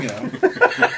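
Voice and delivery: put-on high wheezy old-timer voice; Walter Brennan. Shown as a demonstration. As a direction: cackle it like a grizzled old-timer. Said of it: "Walter Brennan".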